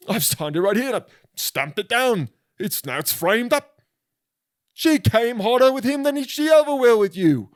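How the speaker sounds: high-pitched, hammed-up British official; formal British notary. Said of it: "like a notary was there".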